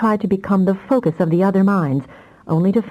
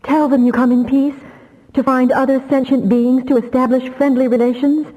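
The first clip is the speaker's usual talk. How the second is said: pleading; 8 semitones higher than usual. Acted. Damia keeps pleading with Soden.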